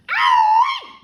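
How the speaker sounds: huge high shriek of pain; yell. Acted. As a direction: scream it out. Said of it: "Grandma yelled".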